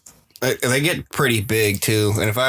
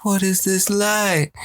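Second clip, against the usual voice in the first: higher, drawn-out, wondering voice; curious little fish; 7 semitones higher, slower than usual. Acted. Little fish lured toward the anglerfish's light, curious and wondering. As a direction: play a fish slowly lured in.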